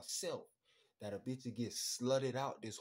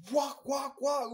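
much higher and louder, squealing; excited squeal; 12 semitones higher, 12 dB louder, slower than usual. Retold or imitated. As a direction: squeal like you cannot believe it.

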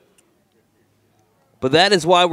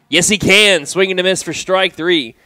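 higher, louder triumphant call; excited call; 5 semitones higher, 6 dB louder than usual. Real, not acted. Announcer calling the strikeout, excited. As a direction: celebrate the strikeout.

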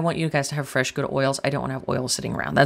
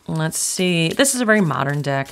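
ordinary talk

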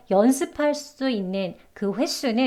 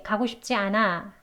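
ordinary delivery

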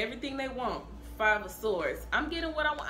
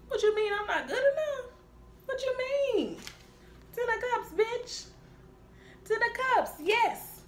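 much higher, whiny and offended; indignant person; 10 semitones higher than usual. Acted. High, offended whine as the reader voices the conflicted person. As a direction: squeal it out like someone deeply insulted.

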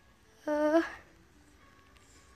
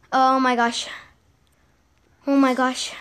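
normal talking voice

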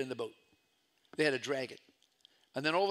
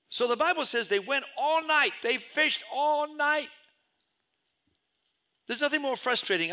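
higher, louder preaching build; preaching full force; 10 semitones higher, 5 dB louder than usual. Acted. Preacher telling the fishing story, at full force. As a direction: lift the congregation with rising fervor.

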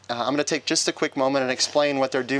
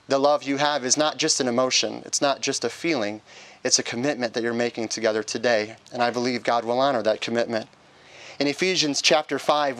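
usual speaking voice

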